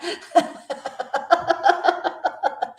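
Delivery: high, rapid run of giggles; real laughing fit. Real, not acted. Streamer cracking up on air, real laughing fit. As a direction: break up into helpless high laughter.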